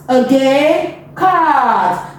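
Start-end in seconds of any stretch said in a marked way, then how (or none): 1.2-2.2 CAT stretched out slowly to the final t; slow phonics demonstration of CAT; slow blend. Shown as a demonstration. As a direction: draw out the vowel in CAT. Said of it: "Now let's blend it slowly".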